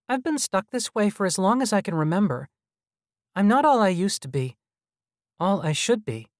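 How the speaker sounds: put-on high child's voice, lamenting; little boy voice, pitched well up; child hologram. A narrator's reading. Hologram confessing to visitors it is stuck, high child voice.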